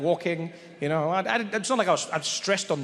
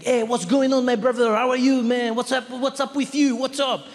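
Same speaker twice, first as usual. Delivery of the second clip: put-on high, loud French-accented greeting; cool French guy; 7 semitones higher, 5 dB louder than usual. French clerk greeting next customer, chummy French accent. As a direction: hail him like a buddy, French accent. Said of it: "but very French, very French, cool guy".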